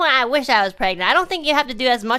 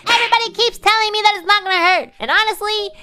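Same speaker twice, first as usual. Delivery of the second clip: loud, high, fast mock rant; dramatized high-pitched protest; exasperated outburst; 11 semitones higher, 7 dB louder, faster than usual. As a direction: burst out, fed up with reassurance. Retold outburst at reassurance.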